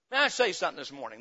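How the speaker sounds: usual speaking voice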